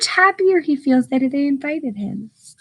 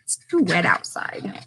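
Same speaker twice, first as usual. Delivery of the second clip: soft whispered aside; 5 semitones lower, 5 dB quieter than usual. Acted. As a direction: whisper it like a secret to the kids. Teacher whispers explanation to kids.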